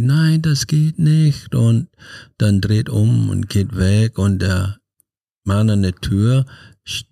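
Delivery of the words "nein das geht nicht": higher, slow mock cry of refusal; retold scream. Mother refusing her son at the door, slow high mock cry. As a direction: draw out the no like a pained outburst. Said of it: "Und dann schreit die".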